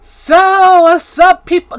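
big loud hyped hello; loud greeting. Real, not acted. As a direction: call it out to the viewers.

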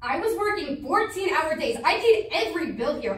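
indignant, emphatic justification; heated self-defence. Acted. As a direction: defend yourself hotly, point by point. Sister defends herself in quarrel.

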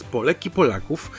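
ordinary delivery, nothing marked

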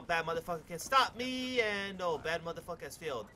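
ordinary talk, nothing marked

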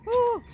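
startled yelp. Real, not acted. Startled by the creature waking.

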